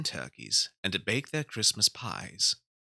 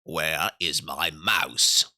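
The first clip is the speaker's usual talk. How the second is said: lower, louder, slow growling cat; cat's voice; 5 semitones lower, 5 dB louder, much slower than usual. Acted. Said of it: "if Simpkin had been able to talk".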